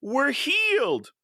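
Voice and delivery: high, slow, mock-flat line delivery; mock lepers' line. Mocking the leper's flat line, high and slow. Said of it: "as she's saying".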